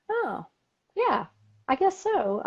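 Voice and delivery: small, very high voice; imitated realization. Woman answers hairdresser's remark on her calm, high tentative agreement. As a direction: retell it high-pitched, as if it just dawned. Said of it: "And I'm like".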